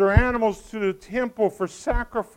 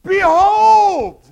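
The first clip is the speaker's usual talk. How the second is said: huge high drawn-out shout; excited scream; 10 semitones higher, 13 dB louder than usual. Acted. John getting attention, hitting the Bible, excited screamed shout. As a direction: scream it to grab everyone's attention. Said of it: "He screams it".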